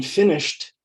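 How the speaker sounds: "finished" ending in a t sound; "finished" said like "finisht". Shown as a demonstration. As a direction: say "finished" as two syllables, final t. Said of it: "the E D sounds like t".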